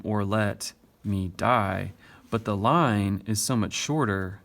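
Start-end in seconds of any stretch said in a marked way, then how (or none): 0.0-1.9 "or let / me die" slow, in two feet; same short-long rhythm. Shown as a demonstration. Teacher showing it is the same rhythm, "or let / me die" slowly beaten out. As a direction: stretch "or let me die" with weight on "let" and "die". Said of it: "it's the same rhythm".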